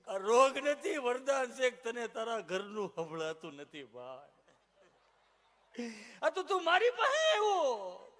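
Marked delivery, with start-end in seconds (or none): 6.2-8.1 high, loud, slow laughing sob; hammed-up tearful doctor's lament; mock crying doctor. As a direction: wail that he has it worse.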